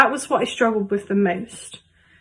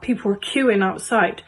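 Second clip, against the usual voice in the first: ordinary delivery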